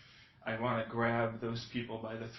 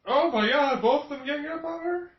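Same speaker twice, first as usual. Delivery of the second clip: outraged shock; 14 semitones higher, 8 dB louder than usual. Real, not acted. Reacting to a brutal fight.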